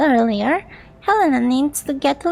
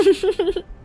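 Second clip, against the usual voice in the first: mocking laugh; 6 semitones higher than usual. Taunting character laughs.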